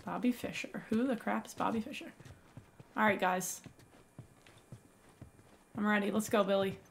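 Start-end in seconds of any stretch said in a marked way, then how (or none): none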